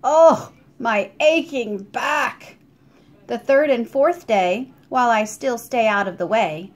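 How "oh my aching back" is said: slow, loud groan of a sore back; an old back giving out. A narrator's reading. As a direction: ache through every word. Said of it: "Landlady Crisp groans".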